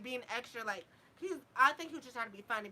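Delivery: ordinary talk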